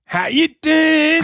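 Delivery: high, loud, drawn-out holler; Lil Mo catchphrase. Retold or imitated. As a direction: belt out the catchphrase, stretching the vowels. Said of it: "And his catchphrase one more time".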